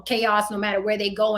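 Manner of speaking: usual speaking voice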